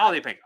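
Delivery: Holly with a short open "ah"; corrected pronunciation. Shown as a demonstration. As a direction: say Holly to rhyme with jolly. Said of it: "not Holy Pink".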